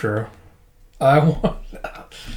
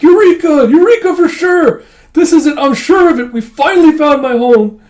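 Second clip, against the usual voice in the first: very high, loud exclamations; jubilant mock shout; 12 semitones higher, 8 dB louder than usual. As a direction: cry out a triumphant discovery, hammed-up. Old man believes home found.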